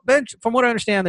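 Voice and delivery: ordinary delivery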